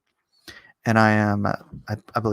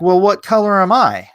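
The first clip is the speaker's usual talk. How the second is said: louder, higher challenge; man confronting a student, louder and pointed; 7 semitones higher, 6 dB louder than usual. Retold or imitated. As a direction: throw it back at him, daring. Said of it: "he's like".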